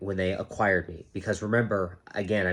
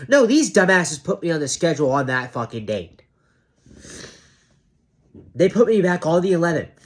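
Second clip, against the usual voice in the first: angry rant; 7 semitones higher, 8 dB louder than usual. Real, not acted. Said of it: "I am getting emphatic".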